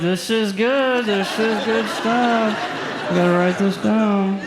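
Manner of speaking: high, slow approving coach voice; mock impressed viewer. Comic cheering Michael on from his couch, mock impressed. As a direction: nod along, mock impressed. Comic taking notes on show.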